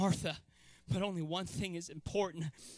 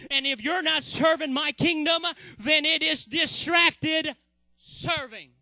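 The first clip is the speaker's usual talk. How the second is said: rising, louder preaching to a shout; 4 semitones higher, 7 dB louder than usual. Acted. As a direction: drive it home from the pulpit.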